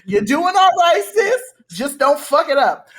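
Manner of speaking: high, loud drag-host encouragement; theatrical encouragement with a warning. Acted. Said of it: "I'ma just say in my [name] voice".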